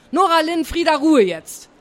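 sharp classroom telling-off; calling to order. A narrator's reading. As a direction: cut through the chatter. Teacher calls two pupils to order.

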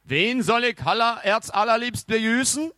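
high, loud indignant question; an old mummy man pipes up, loudly affronted; mummy man's voice. A narrator's reading. Startled mummy questions the two boys, high and loud. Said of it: "eine sich räuspernde Männerstimme fragte".

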